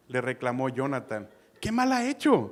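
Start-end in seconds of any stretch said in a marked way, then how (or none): 1.6-2.4 súplica alzada. Retold or imitated. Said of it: "lo está ayudando a que recapacite".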